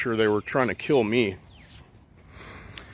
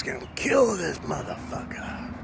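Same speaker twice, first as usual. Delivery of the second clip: whisper; 6 semitones higher, 6 dB quieter than usual. Retold or imitated. Retelling gunman's threat.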